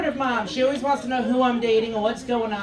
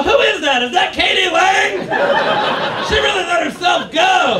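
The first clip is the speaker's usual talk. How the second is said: higher, louder catty gossip shout; catty lesbians; 6 semitones higher, 8 dB louder than usual. Retold or imitated. Lesbians judging the comic, loud catty gossip. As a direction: gossip loudly about her. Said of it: "they go".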